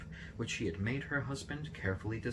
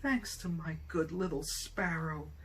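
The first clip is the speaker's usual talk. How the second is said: put-on high, slow, delighted old man; much higher on the first sparrow, beaming with gratitude; overjoyed thanks; 10 semitones higher than usual. A narrator's reading. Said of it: "many times".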